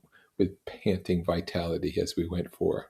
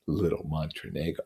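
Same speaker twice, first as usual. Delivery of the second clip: much softer, savoring the words; fond murmur; 3 semitones lower, 7 dB quieter than usual. Acted. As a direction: smile it back to yourself. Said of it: "He lifted up the words and nodded at them with his smile".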